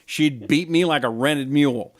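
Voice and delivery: ordinary talk, nothing marked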